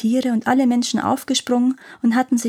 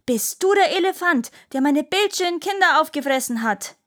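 higher, accusing mother's question; mother confronting elephant; 7 semitones higher than usual. Acted. Mother demands answer from elephant, voice raised and accusing.

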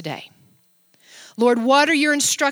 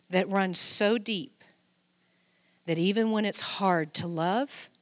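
softer and lower, tender; intimate; 5 semitones lower, 6 dB quieter than usual. Real, not acted. Pastor urges the church to love, soft and intimate. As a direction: draw them in close and gently. Intimate appeal, voice drops low.

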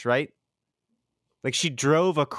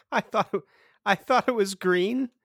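incredulous; 7 semitones higher than usual. Retold or imitated. Incredulous repeat of quote.

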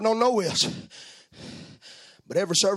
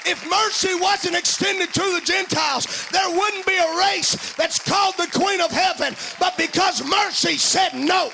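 preaching; 10 semitones higher than usual. Acted. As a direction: preach it out, full force. Preaching at full force, curse.

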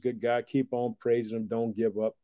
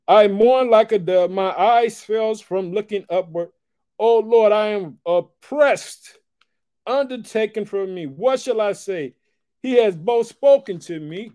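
loud, slow, ringing pulpit reading; preaching at full force; 8 semitones higher, 7 dB louder, slower than usual. Acted. Preacher declares Hezekiah's words to the church, loud and preached. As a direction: proclaim the lament to the back row.

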